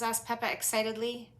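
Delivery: plain reading voice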